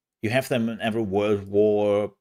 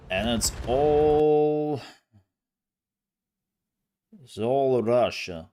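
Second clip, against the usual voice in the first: put-on slow, loud, drawn-out Russian accent; mock Russian accent; 5 semitones higher, 5 dB louder, slower than usual. Streamer jokingly slips into a Russian accent, slow and loud. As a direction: lean into a put-on Russian accent, stretching ALL. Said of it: "I don't know why I talk like this".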